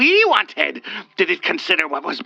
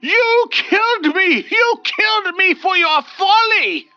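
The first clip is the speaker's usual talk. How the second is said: wailing; 5 dB louder than usual. Acted. The wraith wailing at Olga, high furious wail. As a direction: wail it out in fury. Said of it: "wailing at her".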